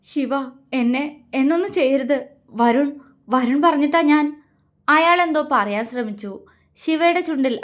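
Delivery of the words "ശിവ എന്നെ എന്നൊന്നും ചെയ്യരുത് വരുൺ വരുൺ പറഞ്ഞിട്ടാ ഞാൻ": halting plea, rising at the end; acted nervous stammering excuse; frightened pleading. A narrator's reading. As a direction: beg for mercy, breaking off between words. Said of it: "അയാളിൽ ഭയം നിറഞ്ഞു".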